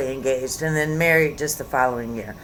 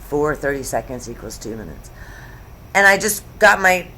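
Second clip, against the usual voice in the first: usual speaking voice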